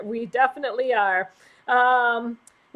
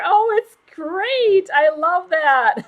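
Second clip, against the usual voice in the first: high, louder and slow with delight; 6 semitones higher, 5 dB louder, slower than usual. Real, not acted. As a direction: gush over the gift. Delighted by a super sticker.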